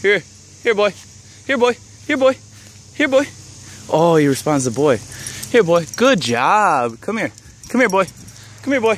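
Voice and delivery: high repeated dog call; calling a lost pet. Real, not acted.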